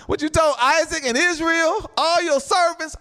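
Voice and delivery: high, insistent challenge to God; impassioned reminder of a promise; Moses pleading. Acted. Moses's plea continues.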